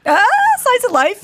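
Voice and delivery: high, bright exclamation; mock excited doctor. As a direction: announce it like a miracle.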